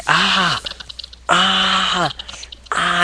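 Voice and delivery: high pained screams; mock screaming. Austin in the submission hold, mock screams. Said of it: "Austin's like".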